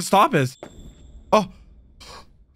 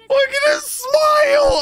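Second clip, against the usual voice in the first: excited. Real, not acted. Fan watching the hero smile, squealing with delight. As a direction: squeal over the smile on screen. Reacting to a touching anime moment.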